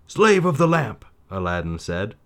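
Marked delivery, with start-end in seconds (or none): 0.1-1.1 high, loud royal command; commanding like a sultan. A narrator's reading. Aladdin commanding the genie as if he were the Sultan. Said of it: "as if he were the Sultan himself".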